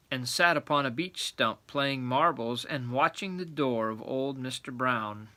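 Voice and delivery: ordinary delivery, nothing marked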